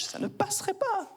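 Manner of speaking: very high, prissy mock disapproval; mock-offended high squeal; shocked tut-tut. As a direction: squeak it out like a scandalized prude.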